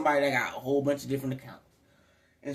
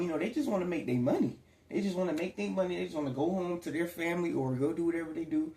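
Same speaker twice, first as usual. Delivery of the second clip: usual speaking voice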